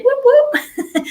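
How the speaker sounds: high whooping cheer, laughing; celebratory whoop breaking into a laugh; excited cheer. Real, not acted. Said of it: "I'm very excited".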